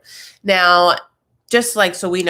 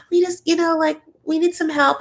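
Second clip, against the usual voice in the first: high, pleading little voice; cousins' plea; 10 semitones higher than usual. Retold or imitated. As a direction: mimic the cousins asking for help in a squeaky voice. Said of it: "being like".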